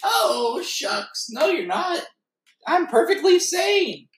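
very high, wobbly goofy voice; silly cartoon sponge. Sponge insisting to co-host he's sane, high goofy voice.

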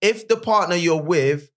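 ordinary talk

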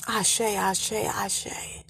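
soft fading ashe, ashe, ashe; whispered blessing. Real, not acted. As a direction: breathe the blessing out softly.

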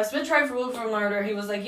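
ordinary talk

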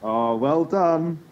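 sarcastic praise. Real, not acted. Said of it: "mock praise".

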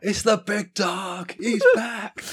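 slow, high, hyped announcement; hype announcer. Pretend. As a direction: hype the crowd up. Hype announcing Gretzky's arrival.

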